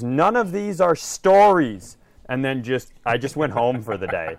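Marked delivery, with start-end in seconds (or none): 0.0-2.0 loud, slow, retold shout; mock shout. Coworker snapping at the server, a loud mock shout. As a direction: shout the line like a fed-up coworker. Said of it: "then just shout the words".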